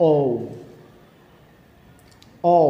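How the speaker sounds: American-accented letter "ও". Shown as a demonstration. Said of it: "আমেরিকানরা এটাকে একটু অন্যভাবে বলে". (আমেরিকান উচ্চারণে "ও")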